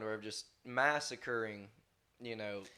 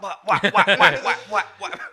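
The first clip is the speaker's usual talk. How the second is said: much louder, higher shouted whack whack; loud whacking noises; 7 semitones higher, 15 dB louder than usual. Retold or imitated. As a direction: bellow the spanking sounds with a laugh. Uncle whipping his son.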